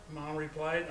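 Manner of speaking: ordinary delivery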